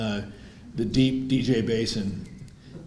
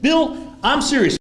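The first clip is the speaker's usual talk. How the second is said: high, loud, heated outburst; acted angry yell; retold shouting; 14 semitones higher, 5 dB louder than usual. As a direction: re-enact a shouting match, pitched way up. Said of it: "we finally stopped shouting at each other".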